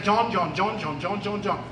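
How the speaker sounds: soft, low, mumbled repeated name; deliberately wrong version, said quietly and low; half-hearted master calling John. Shown as a demonstration. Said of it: "that's not the way he spoke".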